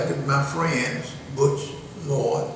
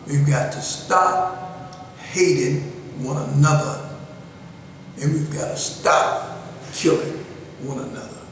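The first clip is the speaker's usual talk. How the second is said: slow, with pauses between phrases; measured, one word group at a time; slow and deliberate; 3 dB quieter than usual. Shown as a demonstration. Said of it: "I'm talking slow so that you can't miss".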